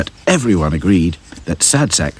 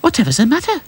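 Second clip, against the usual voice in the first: alarmed; faster than usual. A narrator's reading. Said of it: "said Lucy in alarm".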